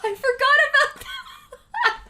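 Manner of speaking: high, loud, helpless laughter through the words; laughing hard. Real, not acted. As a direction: crack up while getting the words out. Cracks up at a forgotten thing.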